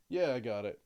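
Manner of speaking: low-key in-character answer; toned-down character voice. Acted. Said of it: "That's better".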